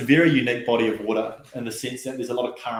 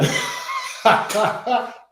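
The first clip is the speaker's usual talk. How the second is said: airy breathy laugh bursting out; 11 semitones higher than usual. Real, not acted. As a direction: laugh at the memory. Laughs after the quote.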